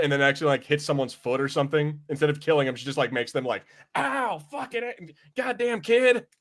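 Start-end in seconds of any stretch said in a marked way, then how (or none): none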